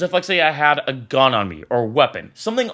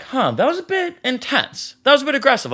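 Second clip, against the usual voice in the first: incredulous; 8 semitones higher than usual. Retold or imitated. Confused by staff's reaction.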